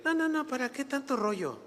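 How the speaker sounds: mocking dismissiveness. Christians impatient with explanation, mocked dismissively.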